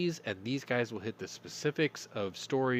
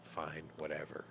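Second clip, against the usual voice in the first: much softer, low sullen give-in; resigned customer; 6 semitones lower, 10 dB quieter than usual. Acted. Customer gives in to the Soviet cashier, sullen. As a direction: mutter it, defeated.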